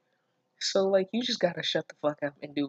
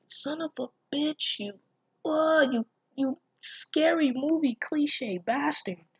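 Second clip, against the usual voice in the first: exasperated outrage; 10 semitones higher, slower than usual. Real, not acted. Outraged reaction to the story.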